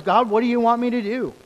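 loud, high pleading prayer; fervent prayer. Acted. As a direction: cry out to God fervently.

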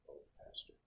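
whispered, sinking groan of dismay; slumped, deflated deacon. Retold or imitated. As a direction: barely voice it, as if all hope has gone. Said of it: "my deacon sitting next to me slumped".

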